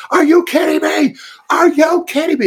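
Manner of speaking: high-pitched, incredulous yell at the screen; mock outraged yelling. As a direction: shriek in mock disbelief, pitch way up. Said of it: "found yourself yelling at your iPhone".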